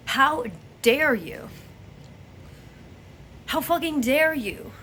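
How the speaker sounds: slow, rising indignation, each word hit; indignant outrage. Real, not acted. Angry rhetorical outburst.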